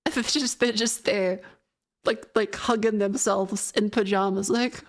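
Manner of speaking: ordinary delivery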